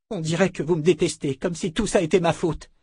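put-on a wounded young girl; hurt girl. A narrator's reading. Beata protests. (Beata proteste, blessée)

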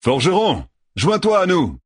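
appel plus aigu et plus fort; héler le forgeron de loin; interpellation. A narrator's reading. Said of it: "Un aïel interpella Perrine". (un Aïel interpelle Perrine en criant)

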